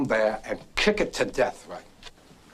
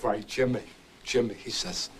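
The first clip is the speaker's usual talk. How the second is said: usual speaking voice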